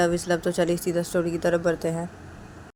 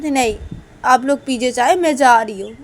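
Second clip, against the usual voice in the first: loud, firm declining; acted upset, announcing departure loudly; huffy refusal; 5 semitones higher, 9 dB louder than usual. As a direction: refuse as the offended one. Uma refuses tea, leaving.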